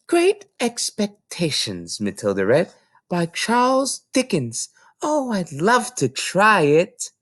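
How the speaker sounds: put-on high, slow child's voice; little girl voice. Little girl reading the book title to the librarian, high and slow. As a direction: read the title with eager delight.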